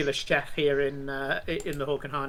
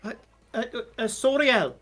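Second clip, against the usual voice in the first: higher, stammering, then calling the name out loud; flustered tavern keeper; 5 semitones higher, 4 dB louder than usual. Acted. Tavern keeper calling the bard over, stammering then loud. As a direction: fumble for the bard's name, then call it. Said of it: "he says".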